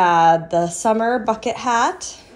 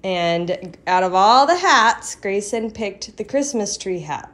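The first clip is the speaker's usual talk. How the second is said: usual speaking voice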